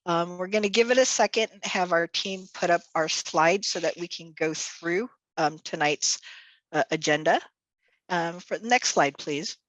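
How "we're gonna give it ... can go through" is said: ordinary talk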